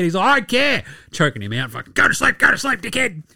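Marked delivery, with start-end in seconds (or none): 0.3-0.8 high, raised comic defiance; loudly acted defiant player; mock defiant yell. Acting out Draymond's defiant reply. 1.9-3.2 high, strained comic aggression; hammed-up yell while pretending to throttle someone; mock attacker. Acting out Draymond choking someone.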